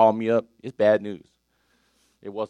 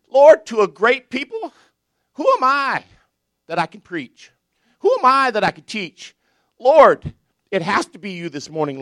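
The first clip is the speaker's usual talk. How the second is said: prayer; 6 semitones higher, 6 dB louder than usual. Acted. Prayer in sermon.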